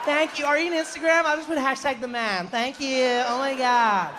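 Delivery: high, drawn-out tourist squeal; mock overexcited tourists in a high voice; gushing tourists. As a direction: gush with long stretched-out final vowels. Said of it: "the people in our tour group were like".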